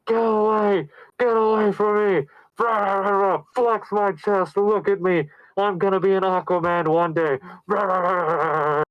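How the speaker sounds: high, strained strongman bluster; mock macho outburst. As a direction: puff yourself up and bellow it theatrically. Acting out the character's outburst.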